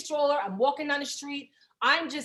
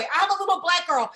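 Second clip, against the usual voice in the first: ordinary talk, nothing marked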